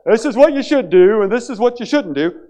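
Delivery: high, loud lecturing voice; a preachy busybody laying down do's and don'ts; bossy know-it-all. Retold or imitated. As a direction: lay down the rules from on high. Bossy instructor who doesn't guide.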